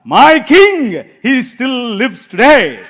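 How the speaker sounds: loud, very slow declaration; triumphant proclamation. Acted. Preacher proclaiming to the church that his king lives, loud and slow. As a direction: ring it out as a victory cry.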